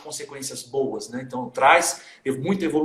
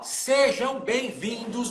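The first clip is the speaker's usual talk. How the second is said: announcer welcome; 8 semitones higher, 4 dB quieter, much slower than usual. Acted. Host welcomes live audience.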